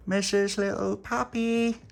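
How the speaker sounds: high, slow sing-song call; calling at door. Acted. Dolores calling for Missus Little Pappy, sing-song.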